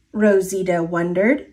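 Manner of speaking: ordinary delivery, nothing marked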